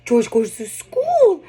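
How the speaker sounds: playful storytime with a laugh; animated storytime. Shown as a demonstration. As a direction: delight the toddler with it. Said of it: "really describing".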